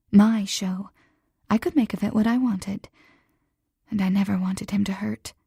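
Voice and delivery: plain reading voice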